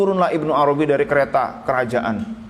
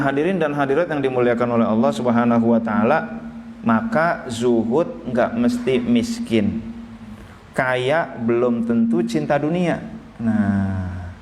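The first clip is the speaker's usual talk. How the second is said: usual speaking voice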